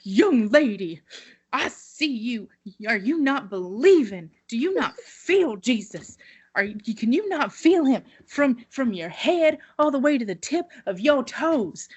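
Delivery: playing the preacher singling out the girl; preacher challenging the girl in the front pew, mock earnest. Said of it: "he's like".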